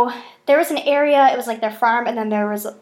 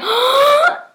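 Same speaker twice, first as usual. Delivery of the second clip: high, loud gasp of shock; sharp gasp; 6 dB louder than usual. Retold or imitated. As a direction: gasp as if you found a ghost clue. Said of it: "And I was like".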